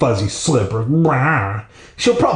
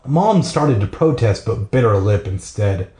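ordinary delivery, nothing marked

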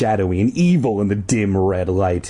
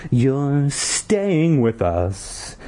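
put-on slow, pitched-up creepy kid voice; mock whisper in the Jelly Boy voice; 4 semitones higher, much slower than usual. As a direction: hiss it slowly like a menacing child. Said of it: "Jelly Boy whispered".

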